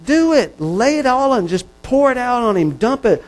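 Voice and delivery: high, loud preaching push; urgent exhortation. Real, not acted. Preacher urging the congregation to dump everything, loud and high. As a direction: urge them to let it all out.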